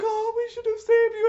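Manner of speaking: high mock lament; mock mournful friends. Friends mourn a hero who is still alive, high mock lament. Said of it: "they're like".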